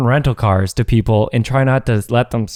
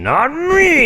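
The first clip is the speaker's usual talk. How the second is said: cocky mock reply. Friend mimics pilot's cocky reply.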